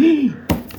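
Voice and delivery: a startled gasp of shock; sharp gasp. Acted. Man finding newspaper gone, startled gasp. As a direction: gasp at the missing paper.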